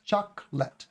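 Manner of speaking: "chocolate" split into choc-let; chocolate in two separated syllables, no O. Shown as a demonstration. Teacher counting two syllables, deliberately segmented pronunciation. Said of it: "two syllables".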